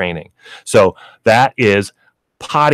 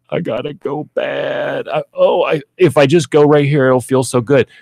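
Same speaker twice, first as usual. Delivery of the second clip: higher, strained dog thoughts; dog's inner voice; 4 semitones higher than usual. Retold or imitated. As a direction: voice the desperate dog. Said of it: "they're like".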